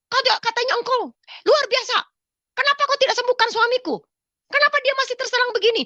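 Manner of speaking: high, loud furious shouting at God; angry challenge. Retold or imitated. Desperate wife shouts a challenge at Jesus, high and loud. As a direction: rage and dare him to heal. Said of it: "saya kan teriak-teriak".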